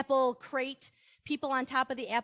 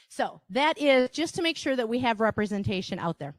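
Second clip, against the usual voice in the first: usual speaking voice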